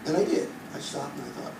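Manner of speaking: usual speaking voice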